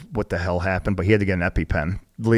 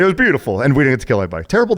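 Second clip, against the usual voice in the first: mock president; 5 semitones higher, 4 dB louder, much faster than usual. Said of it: "I sound like freaking the president".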